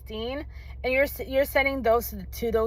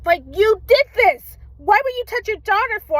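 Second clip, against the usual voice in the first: higher and louder, outraged at the mother; real anger; 11 semitones higher, 7 dB louder than usual. Real, not acted. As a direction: let the outrage burst out at her. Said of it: "I was so angry".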